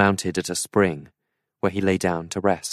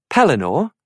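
"Pellinore?" asked higher with a questioning rise; puzzled; 7 semitones higher, 5 dB louder than usual. A narrator's reading. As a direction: repeat the name "Pellinore?" as a baffled question. Arthur puzzled by name.

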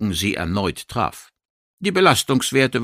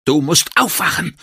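higher, urgent narrated cry; Schreien; 6 semitones higher than usual. A narrator's reading. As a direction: cry out a command to wake up. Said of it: "schrie sie sich zu". (erzählter eindringlicher Schrei)

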